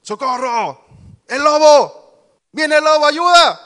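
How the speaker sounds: high, full-volume cry for help; grito de auxilio. Acted. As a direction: shout it out like a shepherd boy faking a wolf alarm. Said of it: "se puso a gritar". (grito agudo y fuerte pidiendo socorro)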